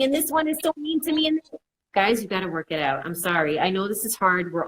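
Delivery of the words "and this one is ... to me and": mock whiny child, pitched much higher; tattling kid. Mom imitating her child tattling on a sibling, in a high mock whine. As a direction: playfully imitate a kid complaining about a sibling. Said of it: "if they come and telling".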